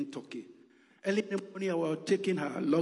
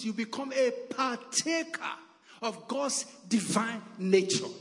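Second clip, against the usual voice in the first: high, loud, emphatic preacher delivery; forceful preaching; 9 semitones higher, 6 dB louder than usual. Real, not acted. Preacher urging brethren to claim God's promises, forceful preaching. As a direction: drive each phrase home to the congregation.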